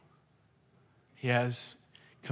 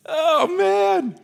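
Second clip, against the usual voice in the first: amused exclamation; 13 semitones higher, 3 dB louder than usual. Real, not acted. Reacting to his own joke.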